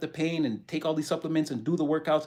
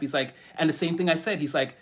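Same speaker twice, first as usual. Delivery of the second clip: ordinary delivery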